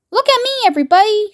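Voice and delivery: high, fast gleeful call for attention; a grandmother showing off her flying; shouted. A narrator's reading. Grandma Josephine shouting to everyone, fast and high. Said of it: "shouted Grandma Josephine".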